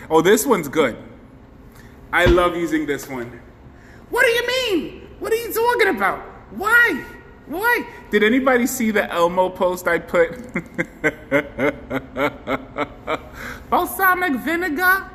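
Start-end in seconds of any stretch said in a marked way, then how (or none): 4.1-7.9 high, fast squeaky questioning. Acted. Streamer testing a squeaky filter on viewers. 13.7-15.1 high filter voice. Acted.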